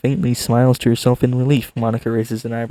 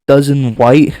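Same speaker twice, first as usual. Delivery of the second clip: incredulous; 3 semitones higher, 9 dB louder, slower than usual. Real, not acted.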